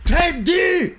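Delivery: loud, high jeering cry of the word; mocking shout. Host shouts the secret to the crowd, mocking and loud. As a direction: shout it out to shame him. Host reads out the embarrassing post.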